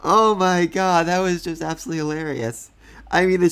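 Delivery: a real helpless laugh breaking out. Real, not acted. Cracking up at the scene.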